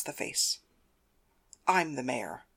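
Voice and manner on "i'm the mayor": low, soft, controlled claim; hushed, lowered-voice authority; cold correction. Acted. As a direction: quietly take the title from him. Florence corrects him after slap.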